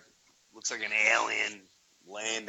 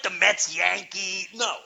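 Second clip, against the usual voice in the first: usual speaking voice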